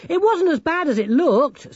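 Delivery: very high, loud character voice; excited Sacco. Acted. Voices Sacco recounting adventure.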